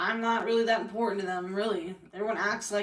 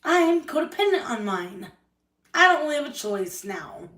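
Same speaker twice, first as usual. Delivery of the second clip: higher, louder admission; bitter outburst; 6 semitones higher, 5 dB louder than usual. Real, not acted. To the viewers about her family, bitter raised admission. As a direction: blurt it out, stung.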